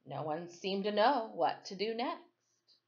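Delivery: usual speaking voice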